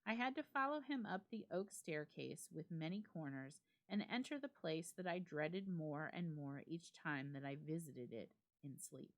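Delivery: ordinary delivery, nothing marked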